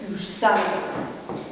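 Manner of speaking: low, slow insult, held back; retold seething anger. Furious sister answering her towering brother, seething. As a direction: seethe it under your breath, breaking off. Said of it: "I was so angry".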